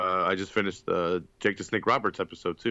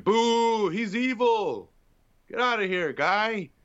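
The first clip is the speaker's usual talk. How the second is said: high, slow hammed-up heckle; mock booing fan; 12 semitones higher, 3 dB louder, slower than usual. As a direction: boo the heel like a fan. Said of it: "I'm like".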